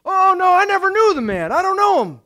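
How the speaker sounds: high, loud mock protest; hammed-up protest of innocence; emphatic denial. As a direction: deny it loudly and hastily. Peter's panicked denial, acted.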